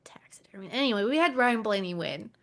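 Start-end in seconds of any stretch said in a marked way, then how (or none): 0.1-0.7 hushed whisper. Real, not acted. Repeating the word in a whisper.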